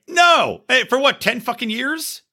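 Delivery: high, incredulous ranting; retold outrage at the dealer asking for receipts; exasperated outrage. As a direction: scoff at a ridiculous demand.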